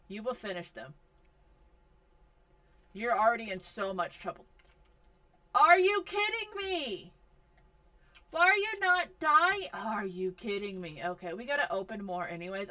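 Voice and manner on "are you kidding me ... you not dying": higher, louder disbelieving yell; loud exasperated disbelief; exasperated outburst. Real, not acted. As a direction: blurt out your disbelief, loud and high. Exasperated at game event.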